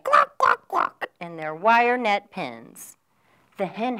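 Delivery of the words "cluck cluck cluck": high, loud hen clucks; clucking hens. Acted. Storyteller voicing the hens for children, high clucking. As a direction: squawk it like a hen.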